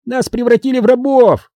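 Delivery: high-pitched gnome lament; high outcry of complaint; exclaiming. A narrator's reading. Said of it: "воскликнул Уэсли".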